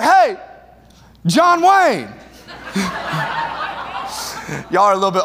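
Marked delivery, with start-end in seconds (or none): 0.0-2.1 high, loud teasing shout across the gym; mock call-out. Calling across the gym to the cowboy in the stands, loud teasing shout. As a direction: yell it at him across the room to get a rise.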